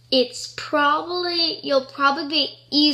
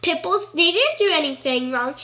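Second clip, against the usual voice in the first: normal talking voice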